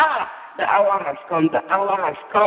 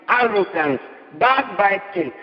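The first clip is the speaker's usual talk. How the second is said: ordinary delivery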